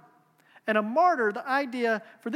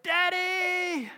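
put-on high piercing child's scream of Daddy; mock scream; 8 semitones higher than usual. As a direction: cry out like a frightened child. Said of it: "this blood-curdling scream of".

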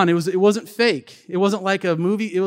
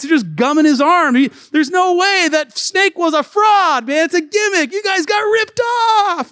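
from high and raised up to a very high shout; amused local pastor getting louder toward the end; mocking laughter building; 8 semitones higher, 4 dB louder than usual. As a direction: tease them, rising to a gleeful yell. Local pastor mocks them, laughing.

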